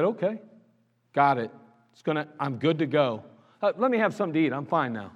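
low-key, matter-of-fact contentment; easy acceptance. Acted. Woman reassured by the man of God, easily content. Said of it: "she said".

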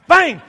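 sudden loud, high "bang"; shouted impact noise. Retold or imitated. Man hitting rat with piece of wood, explosive noise. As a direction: punch out the noise of a blow.

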